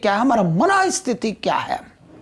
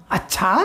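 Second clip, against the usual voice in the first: अच्छा said low with a light lift at the end; a low, questioning अच्छा; different feel on अच्छा; 4 semitones lower than usual. Shown as a demonstration. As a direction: give अच्छा a small lift on its second syllable. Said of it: "हर बार एक अलग छाप".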